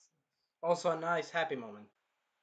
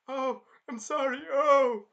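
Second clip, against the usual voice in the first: mock pained apology; 8 semitones higher, 7 dB louder, slower than usual. Spear hero pained apology.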